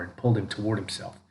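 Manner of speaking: ordinary delivery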